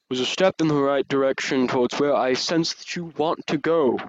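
loud, solemn voice of a god; booming dragon god. Acted. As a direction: speak as a vast dragon deity, loud and grave. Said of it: "I haven't practiced this voice".

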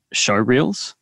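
usual speaking voice

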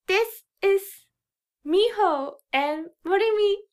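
put-on high, bright anime girl voice in English; high cute anime girl. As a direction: greet listeners like a cute anime heroine. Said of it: "かわいいアニメボイスで". (かわいいアニメ声)

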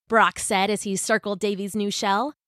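usual speaking voice

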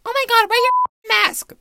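very high, loud preachy mock voice; high scolding self-parody; mocking past self. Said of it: "I used to be, like, super".

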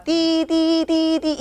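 high mock alarm beeping; imitated beeping alarm; alarm beeps. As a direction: beep like a monitor alarm.

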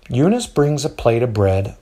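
plain reading voice

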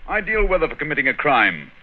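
high, animated outburst; theatrical, keyed-up retort; impatient exclamation. Acted. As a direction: leap up in pitch, then settle slightly. Holmes impatiently points out the weather.

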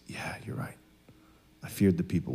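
very soft, low acted confession; imitating a humbled man owning up; quiet admission. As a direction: give in under your breath. Saul finally admits fault, retold.

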